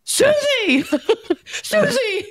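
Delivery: high excited yell across the room, with a laugh; giddy nervous shout of a name; yelling her name. Retold or imitated. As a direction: call out to a friend you just spotted. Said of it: "I, like, yelled her name".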